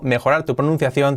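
ordinary talk

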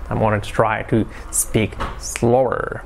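spaced-out words, drawing out the last one. Shown as a demonstration. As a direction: make a careful effort to slow right down. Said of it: "a little bit slower".

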